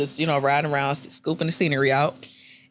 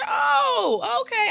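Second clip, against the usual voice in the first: sarcastic okay; much slower than usual. Retold or imitated. Sarcastic reply as he walks off.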